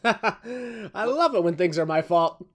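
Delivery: slow, laughing mock delight; laughing sarcasm. Real, not acted. Co-host laughing as he takes the blame, laughing. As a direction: laugh through the sarcasm, stretched out.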